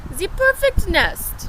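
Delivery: high and loud, full of wonder; excited cry. Acted. Duck admiring the nest, high loud delighted cry. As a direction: exclaim as if it were treasure. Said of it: "she cried".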